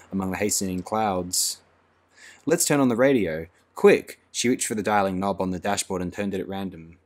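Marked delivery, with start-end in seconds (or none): 2.5-4.2 louder, much higher on quick; frightened urgency. A narrator's reading. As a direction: rush it out in fear. Frightened Lenina urges radio on.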